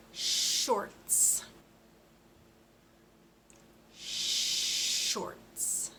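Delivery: shorts with a long unvoiced sh; careful shorts. Shown as a demonstration. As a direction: stretch the sh in shorts. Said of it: "here's our next one".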